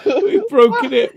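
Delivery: high-pitched, slow, broken up by laughter; laughing. Real, not acted. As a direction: laugh it out in disbelief. Laughing after breaking something.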